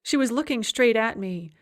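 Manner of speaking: usual speaking voice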